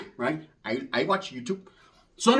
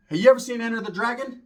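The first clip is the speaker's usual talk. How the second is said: ordinary delivery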